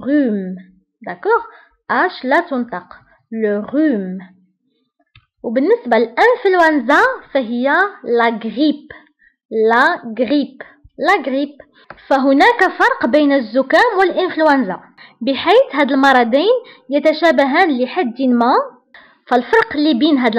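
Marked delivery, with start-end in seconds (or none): none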